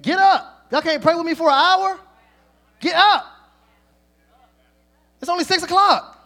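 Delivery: high, loud scolding yell; exasperated shout. Retold or imitated. Preacher voicing Jesus waking the sleeping disciples, exasperated shout.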